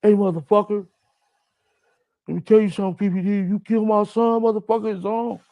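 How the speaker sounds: hammed-up furious police captain; angry cop threat. Police captain threatens him over his son, mock furious. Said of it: "jumped out of his car and like".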